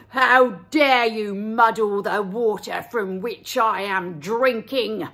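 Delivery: a big bad wolf bellowing. Wolf calls to lamb.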